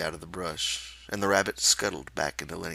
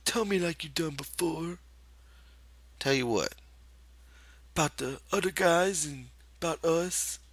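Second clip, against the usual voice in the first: crafty coaxing; 11 semitones higher than usual. Acted. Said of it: "Lenny said craftily".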